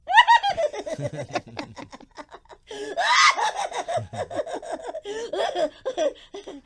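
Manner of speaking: loud high shrieking giggle; tickled shrieking laughter. Acted. Parrot puppet being tickled, loud shrieking laugh. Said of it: "I'm gonna tickle her".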